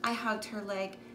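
ordinary delivery, nothing marked

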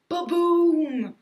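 loud, high, long-held explosion word; booming cannon blast imitation. A narrator's reading. Narrator as sand cannon goes off, booming blast. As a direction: boom it out and stretch the ending.

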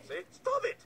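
sudden high-pitched scolding; exasperated snap. Acted. Telling a partner to quit it, exasperated snap. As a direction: cut him off, exasperated and high.

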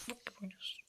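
hushed whispered jab. Real, not acted. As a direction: whisper the insult so she won't hear. Whispered insult to sister.

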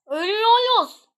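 high, loud cry of alarm. Real, not acted. Player sees the team dying, alarmed cry. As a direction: call out as if doom is seconds away. About to die in game.

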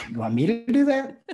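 high, loud incredulous question with a laugh; "who, me?" disbelief, pitched high; confused disbelief. Retold or imitated. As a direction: act out being baffled, pitch shooting up. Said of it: "I was so confused".

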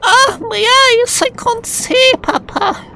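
high, slow mock wail; mock agony. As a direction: wail it like a hammy victim. Blinded by bright light.

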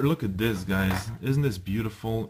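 usual speaking voice

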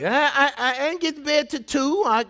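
ordinary delivery

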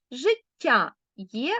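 життя є spoken very slowly; slow demonstration. Shown as a demonstration. Teacher explaining word parts, very slowly. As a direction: stretch життя є out so the parts are heard. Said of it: "Так само життєдайний".

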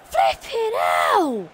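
drawn-out exasperated yell, very slow; furious gamer venting at the screen; frustrated shout. Real, not acted. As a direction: stretch out the curse in disgust. Frustrated after conceding.

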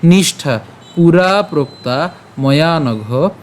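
"নিষ্ঠা পুরা প্রক্তা ময়া নঘ" slow, word by word; pronunciation demo. Shown as a demonstration. Teacher goes through the split verse, slow word-by-word demo. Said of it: "খেয়াল করুন উচ্চারণটা কেমন হচ্ছে".

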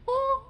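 very high-pitched "oh"; mock scream gasp. Lecturer mimicking the scream emoji, high mock gasp.

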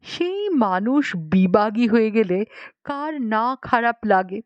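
ordinary delivery

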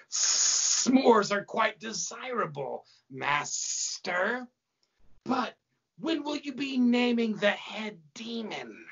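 high, slow hissing snake woman; snake-faced demon. A narrator's reading. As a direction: draw out every S like a snake. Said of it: "woman snake-faced demon named Slithotheus".

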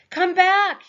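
high, loud furious shout; furious yell. Acted. Torak yelling furiously after the fleeing girl. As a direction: hurl it after her in rage. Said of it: "yelled Torak furiously".

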